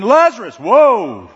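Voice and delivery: a booming wake-up call; attention-grabbing shout. Acted. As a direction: snap the room to attention. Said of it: "Jesus wants to get their attention".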